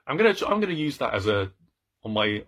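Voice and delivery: ordinary talk, nothing marked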